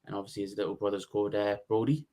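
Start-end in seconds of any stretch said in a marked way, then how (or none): none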